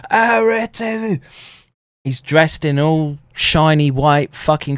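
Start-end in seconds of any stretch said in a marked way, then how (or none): 0.1-1.2 shouting Arrêtez-vous at a fleeing man; retold police shout. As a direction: yell it like a cop ordering a stop. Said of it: "at me, screaming".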